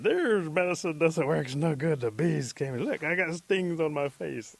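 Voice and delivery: worked-up, aggrieved scolding; angry complaint. Acted. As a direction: protest loudly to whoever sold you a useless cure. Stung man returns to complain.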